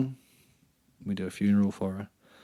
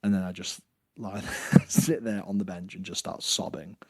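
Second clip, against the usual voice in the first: ordinary talk, nothing marked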